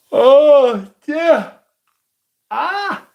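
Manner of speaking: very high, loud surprised oh dear; startled cry. Real, not acted. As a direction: yelp it out in sudden surprise. Startled outburst.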